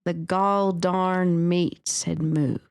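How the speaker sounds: slow, lightly put-on Southern accent. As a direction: drag the words out a little in a mild Southern accent. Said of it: "in as Southern accent as we possibly can".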